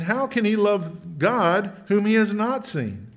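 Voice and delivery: usual speaking voice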